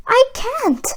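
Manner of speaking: lightly voiced mother, high and sad; a hint of a grieving mother saying no; a light touch of a sorrowful mother; mother's sad refusal. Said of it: "Jack's mother told him".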